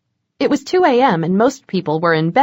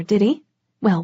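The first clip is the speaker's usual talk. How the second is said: plain reading voice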